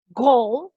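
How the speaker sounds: "goal" with a punched G; "goal" as an English model word, strong G; emphasized English G. Shown as a demonstration. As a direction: lean hard on the G of "goal". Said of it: "Un poquito más enfatizado".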